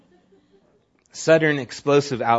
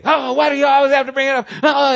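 high, loud, fast angry retort; fast, high defensive outburst; defensive anger; 13 semitones higher, 8 dB louder, faster than usual. Retold or imitated. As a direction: snap back to shut the talk down. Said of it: "they'll get angry".

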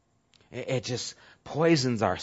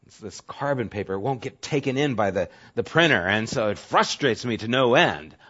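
ordinary delivery

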